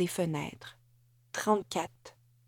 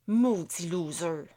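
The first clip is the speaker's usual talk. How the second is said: grumbled insult; 2 semitones lower than usual. Acted. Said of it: "maugréa Amélie".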